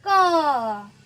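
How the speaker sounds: क held long as nasal "kaan"; क with its vowel sustained through the nose; nasalized vowel. Shown as a demonstration. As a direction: model a drawn-out nasal क. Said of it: "उसका उच्चारण कैसे होएगा".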